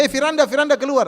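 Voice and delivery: high, loud call at the door; mock rude visitor shouting a name. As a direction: call him out rudely. Imagined guest calling rudely.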